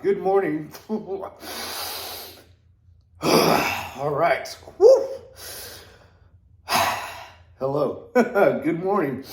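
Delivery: gasping after a cold plunge; breathless from the icy water; in ice cold water. Real, not acted. Just dunked head in cold plunge.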